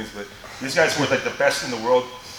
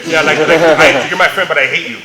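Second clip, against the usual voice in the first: loud, emphatic mock outburst at a friend; mock hatred; 11 dB louder than usual. Man teasing a jerk friend he hates, mock hatred. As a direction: playfully overblown exasperation, loudly. Acts out hating his friend.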